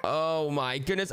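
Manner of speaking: normal talking voice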